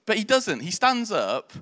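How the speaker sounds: ordinary talk, nothing marked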